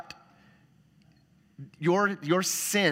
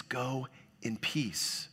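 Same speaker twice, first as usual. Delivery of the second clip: soft, slow blessing; 9 dB quieter, much slower than usual. Retold or imitated. Jesus says, quoted softly.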